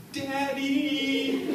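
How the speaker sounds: high, drawn-out wail of "Daddy!"; wailing little girl. Shown as a demonstration. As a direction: cry out "Daddy!" like a three-year-old in distress, stretched and high. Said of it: "except it sounded like this".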